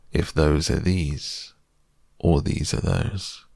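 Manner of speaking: slow, spaced-out verse with pauses; poem recitation. Acted. Pooh recites his own verse to Kanga, deliberate recitation. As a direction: recite each short line deliberately, letting it hang.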